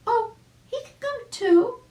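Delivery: very high, loud eager chick cry. Acted. Arabella inviting the dog too, very high and loud. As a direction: burst in excitedly. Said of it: "spoke Arabella".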